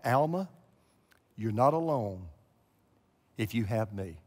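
a low, soft voice calling her name; the voice of God. Retold or imitated. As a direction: speak it gently as the voice she heard. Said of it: "the voice came back".

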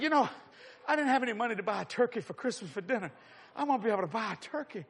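normal talking voice

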